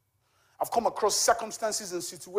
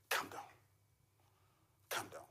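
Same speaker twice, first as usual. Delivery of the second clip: soft soothing; 17 dB quieter than usual. Retold or imitated. Soothing a crying caller.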